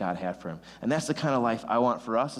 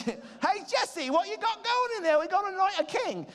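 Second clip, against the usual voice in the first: squeaky, much higher mock British accent, slow. Samuel at Jesse's door, shrill mock British accent. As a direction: play a silly posh British caller with a mock British accent. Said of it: "I should have done it British".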